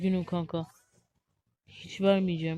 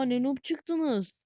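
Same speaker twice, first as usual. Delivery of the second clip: mock teasing; 5 semitones higher, much slower than usual. Real, not acted. Teasing his noob teammates.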